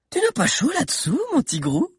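high, sweet teasing voice; girl playfully teasing the boy in the tiger costume. Acted. Sabi teases the tiger boy.

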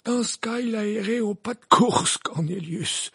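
high-pitched, even, composed voice; reply evenly in a high voice; calm Dumbledore. A narrator's reading. Dumbledore correcting Cornelius, very calm. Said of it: "d'une voix très calme".

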